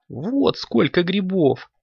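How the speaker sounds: slow, high-pitched kitten voice; proud kitten. A narrator's reading. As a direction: draw it out high, showing off. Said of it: "сказал Коржик".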